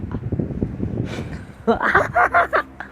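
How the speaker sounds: airy high-pitched laugh; high squealing laugh. Real, not acted. Vlogger laughing at her own joke, squealing laugh. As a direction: squeal with amusement.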